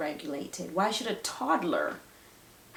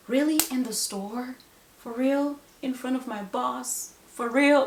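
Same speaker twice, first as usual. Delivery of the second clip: higher-pitched, exasperated questions; frustrated disbelief; 4 semitones higher than usual. Acted. Parent faced with a tantrum in public, exasperated disbelief. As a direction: parent at wit's end, acted out. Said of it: "the frustration just builds up".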